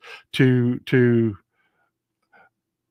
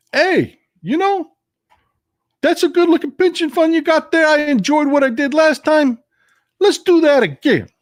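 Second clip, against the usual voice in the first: sleazy loan shark, pitched way up; Wise Guy Jimmy; 13 semitones higher, 3 dB louder than usual. Retold or imitated. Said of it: "run against Wise Guy Jimmy".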